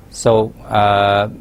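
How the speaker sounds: ordinary talk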